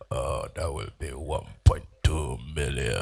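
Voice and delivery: very low, slow price quote. Retold or imitated. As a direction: go as deep as you can, outdoing him. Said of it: "I taking my own times eighty".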